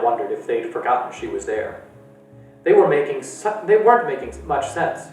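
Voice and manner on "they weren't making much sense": usual speaking voice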